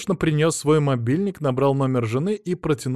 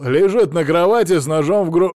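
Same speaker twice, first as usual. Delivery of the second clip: loud, raised cry cut off mid-word; shouting; 5 semitones higher, 7 dB louder than usual. A narrator's reading. Husband reporting his murdered wife, shouting. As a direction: shout it and break off abruptly. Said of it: "кричал он так".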